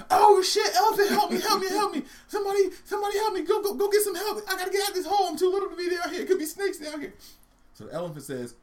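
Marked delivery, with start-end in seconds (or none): 0.1-7.1 very high, loud squealing mouse; hammed-up frantic cries for rescue; panicked screaming. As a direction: shriek for help as a tiny trapped mouse. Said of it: "he starts screaming".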